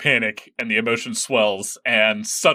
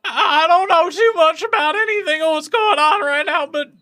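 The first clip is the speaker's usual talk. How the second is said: very high and louder with a laugh; penguin voice; 6 dB louder than usual. Acted. The penguin answers Bryn in a high, laughing squeak. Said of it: "I'm tired of doing that voice".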